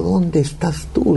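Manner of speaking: high, drawn-out plea without extra volume; anguished call pitched well up; retold crying out. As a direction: cry out to God, stretching each word. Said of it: "comienzo a gritar y le digo".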